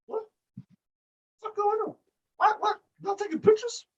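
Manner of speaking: very high, squeaky bewildered voice; Big Dick Vic. Retold or imitated. Fan mimics a wrestler caught off guard, high-pitched bewilderment. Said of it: "Big Dick Vic looking back like".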